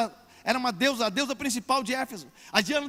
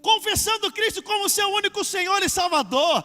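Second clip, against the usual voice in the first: forceful preaching, raised voice; pregação enérgica; 6 semitones higher, 6 dB louder than usual. Acted. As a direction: preach it loud and high to the congregation. Pregação em voz cheia. (pregação enérgica, voz elevada)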